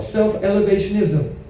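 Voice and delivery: usual speaking voice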